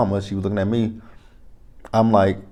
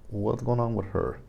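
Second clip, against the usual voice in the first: hushed aside; 2 semitones higher, 8 dB quieter than usual. Retold or imitated. Aside to mama about odd cousin.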